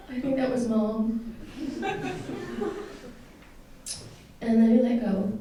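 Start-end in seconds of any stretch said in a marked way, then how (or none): 0.1-2.8 gentle tearful chuckle; laughing through tears. Real, not acted. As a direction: laugh it off while still moved.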